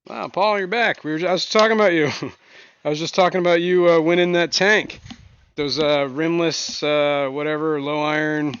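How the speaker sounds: loud, high, lively welcome; excited greeting. Real, not acted. Greeting returning viewer excitedly.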